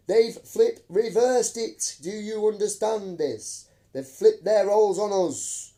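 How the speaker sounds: slow, loud, high indignant ranting. Real, not acted. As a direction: hammer it out, outraged. Ranting, outraged at the system.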